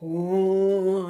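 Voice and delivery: long sustained moan; imitated moaning. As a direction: draw the moan out steadily. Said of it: "like, moaning, like".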